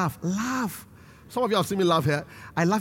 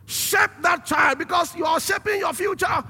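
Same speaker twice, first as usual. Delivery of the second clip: raised, high-pitched preaching charge; forceful exhortation; 6 semitones higher than usual. Real, not acted. Preacher charging parents about their child, forceful exhortation. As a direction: drive it home to the congregation.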